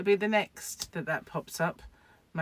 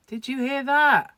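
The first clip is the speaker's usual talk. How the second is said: surprised; 7 semitones higher, 7 dB louder, slower than usual. Real, not acted. Reacting to loud noise.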